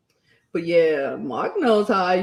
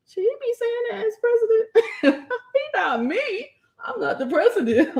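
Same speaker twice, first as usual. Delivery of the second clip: high voice breaking into laughter; laughing disbelief; 13 semitones higher than usual. Retold or imitated. Wondering about the president's words, laughing high disbelief. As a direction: ask it in amused disbelief, giggling.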